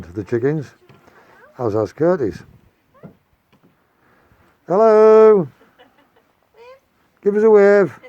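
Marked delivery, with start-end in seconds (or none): 4.7-8.0 loud, high call across the yard. Real, not acted. Calling out to grandchildren leaving.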